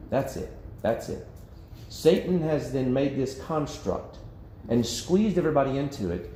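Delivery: usual speaking voice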